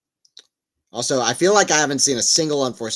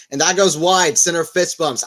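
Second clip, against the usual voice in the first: excited call; 6 semitones higher, 7 dB louder than usual. Real, not acted. Calling the point ending.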